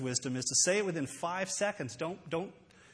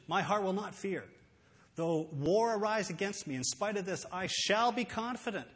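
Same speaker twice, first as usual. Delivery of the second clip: higher-pitched, emphatic declaration of confidence; determined; 4 semitones higher than usual. Shown as a demonstration. A man determining not to worry or fear, determined. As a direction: declare it as a firm decision. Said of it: "This is a man determining to not worry".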